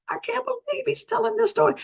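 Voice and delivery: wife silently reacting to her husband's story, mock disbelief; disbelieving wife. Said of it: "his wife is looking at him like".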